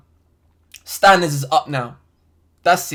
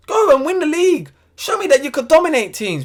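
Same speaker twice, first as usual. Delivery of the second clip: high, louder, urgent demand; pleading challenge; 9 semitones higher, 3 dB louder than usual. Real, not acted. Vlogger daring his team to dominate, high urgent plea. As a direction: throw it at the team like a dare.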